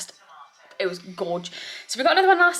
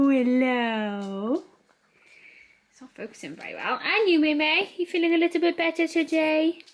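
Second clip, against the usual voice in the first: higher, gentle voice for a poorly pet; 5 semitones higher than usual. Real, not acted. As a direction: coo tenderly at the little dog. Cooing to sick dog.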